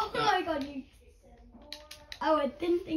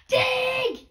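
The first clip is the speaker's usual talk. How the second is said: sharp high panicked shout; panicking gamer; 8 semitones higher, 5 dB louder than usual. Real, not acted. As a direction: bark the order in panic. Under attack in game.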